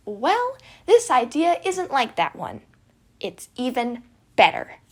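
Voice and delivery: excited exclaiming. Acted. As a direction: sell the wild plan with glee. Remus pitching his plan.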